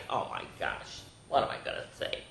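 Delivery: breathy low whisper; whispered aside. Real, not acted. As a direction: whisper it to yourself. Embarrassed aside.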